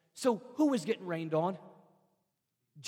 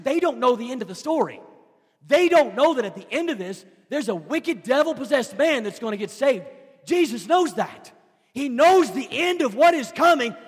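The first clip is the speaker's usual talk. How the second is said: loud, high, driving sermon cadence; full-force preaching; 8 semitones higher, 13 dB louder than usual. Acted. Preacher to his church, rising fervent preaching. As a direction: preach it hard to the congregation.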